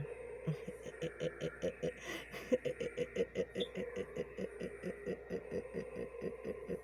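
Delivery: high, breathy drawn-out cackle; wicked breathy laugh; long witch cackle. Retold or imitated. Witch laughs after her line.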